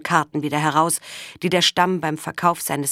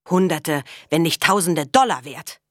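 plain reading voice

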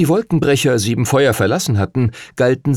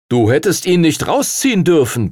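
complaining; 6 semitones higher than usual. Acted. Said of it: "beschwerte sich Edward".

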